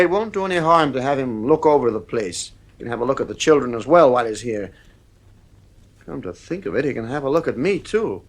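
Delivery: ordinary delivery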